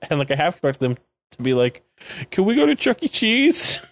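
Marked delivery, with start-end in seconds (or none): 2.3-3.8 put-on high, childish pleading whine; hammed-up crybaby voice, pitched way up; mock crybaby. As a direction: beg like a whiny little kid. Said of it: "such a crybaby here".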